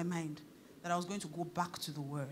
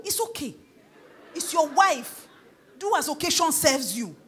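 loud, high, emphatic preacher; full-force preaching; 6 semitones higher, 10 dB louder than usual. Real, not acted. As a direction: drive it home to the congregation, loudly.

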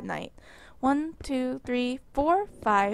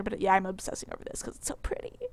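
whisper; 5 semitones lower, 7 dB quieter, faster than usual. Real, not acted. Gushing to self.

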